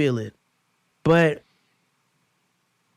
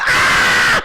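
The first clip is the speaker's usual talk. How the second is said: sharp scream, much higher and louder; startled scream; 8 dB louder than usual. Retold or imitated. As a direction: yelp as if caught out. Startled by knocking question.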